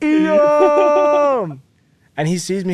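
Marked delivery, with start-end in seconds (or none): 0.0-1.6 long high shout of the name; calling out across the room. Retold or imitated. Spotting his partner across the crowded theater, a long high call. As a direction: yell it like Tom Hanks to the volleyball. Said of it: "Tom Hanks screaming at a volleyball".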